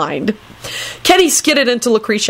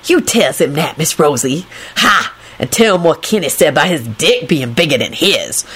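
conspiratorial; 2 semitones lower than usual. A narrator's reading. Lucretia egging Rosie on, conspiratorially. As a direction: confide it as a sly suggestion. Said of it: "she whispered conspiratorially".

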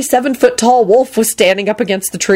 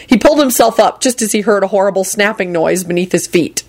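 plain reading voice

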